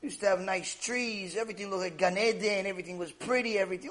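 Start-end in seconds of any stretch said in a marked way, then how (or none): none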